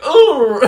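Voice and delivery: high "oh!" breaking into laughter; hammed-up groan of sympathy; mock pained cry. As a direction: wince aloud, half laughing. Retells own reaction to insult.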